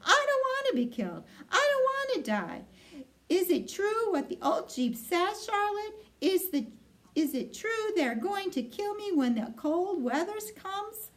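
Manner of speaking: high, fast, frantic; panicked pleading. Acted. Terrified pig begging Charlotte for the truth. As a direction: race the words out in terror.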